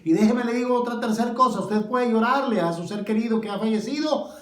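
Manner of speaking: loud, high-pitched preacher's cry; fiery preaching. Acted. Pastor preaching to grieving listeners, loud and fervent.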